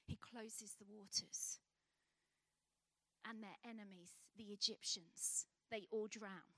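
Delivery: grave hushed narration; hushed storytelling. A narrator's reading. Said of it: "storyteller hushes for climax".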